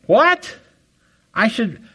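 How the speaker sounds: sudden high squeak of shock; shocked disbelief. Retold or imitated. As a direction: yelp in stunned outrage. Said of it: "that's what my brain was going".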